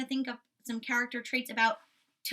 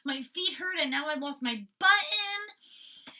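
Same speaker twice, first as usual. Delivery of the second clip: a hint of grumpy Toad, higher and slow; lightly voiced whiny complaint; mildly grumpy higher toad voice; 6 semitones higher, 3 dB louder, slower than usual. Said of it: "Toad was so grumpy".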